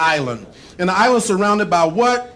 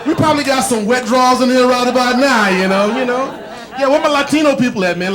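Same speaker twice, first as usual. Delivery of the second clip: ordinary delivery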